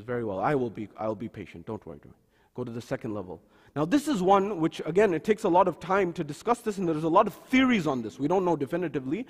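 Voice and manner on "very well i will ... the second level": low and much softer, apologetic; meek. Retold or imitated. Musa promises Khidr patience, meek and soft. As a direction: shrink back, promise patience.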